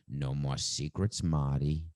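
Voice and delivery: lightly voiced movie quote, low and slow; Ben Kingsley. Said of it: "I can't do his accent".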